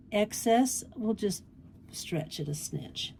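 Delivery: ordinary delivery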